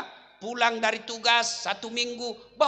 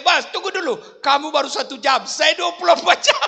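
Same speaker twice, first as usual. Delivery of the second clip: husband's loud plea; 9 semitones higher, 10 dB louder than usual. Retold or imitated. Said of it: "Kata suami".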